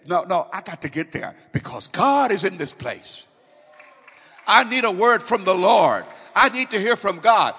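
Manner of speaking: loud, pleading cry of a churchgoer pushing in to hear; shouted plea to be let in; eager shout. Acted. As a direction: call out hungry for a word from the Lord.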